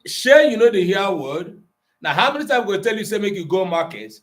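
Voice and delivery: exasperated Nigerian Pidgin parent; Nigerian Pidgin scolding. Shown as a demonstration. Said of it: "don't bring am for pidgin".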